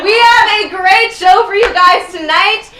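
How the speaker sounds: loud, high host announcing to a crowd; show announcer. Acted. Host announces tonight's guests to the audience, loud announcer voice. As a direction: call out the line-up, stretching big words.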